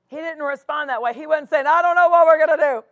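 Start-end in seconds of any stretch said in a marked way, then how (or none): 1.7-2.8 mock panic. Imitating a panicked reply.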